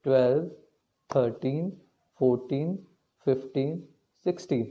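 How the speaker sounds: slow, even beat count, one number per pulse. Shown as a demonstration. Teacher counting taal beats aloud for students, slow and even. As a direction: tick off each beat steadily.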